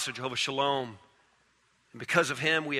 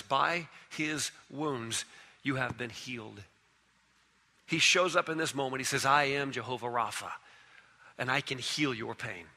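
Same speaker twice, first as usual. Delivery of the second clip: tender hush; 4 semitones lower, 7 dB quieter than usual. Acted. Preacher comforting the hurting, soft and low. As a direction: ease it down gently like a comfort. Softened healing passage.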